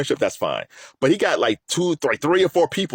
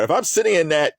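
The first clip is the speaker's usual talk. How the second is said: ordinary talk, nothing marked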